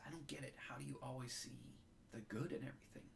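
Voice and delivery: retelling the scene quietly, holding back tears; getting moved. Real, not acted. Podcaster retelling Adam's line to his grandfather, quietly moved. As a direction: voice softens as the emotion creeps in. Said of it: "I'm getting moved here".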